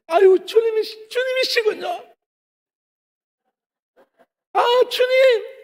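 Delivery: put-on teen at heaven's gate greeting Jesus, high cutesy shout; cutesy gushing. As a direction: gush over the Lord like an eager child, drawing out the last call. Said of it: "애교 떨고 있는 거예요".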